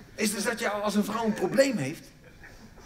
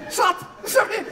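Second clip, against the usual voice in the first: sudden yell; 13 semitones higher, 6 dB louder, slower than usual. Real, not acted. Shout then apology.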